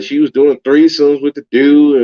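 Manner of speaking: ordinary talk, nothing marked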